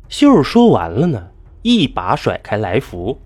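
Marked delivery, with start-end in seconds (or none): none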